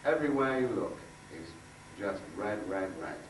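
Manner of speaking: ordinary talk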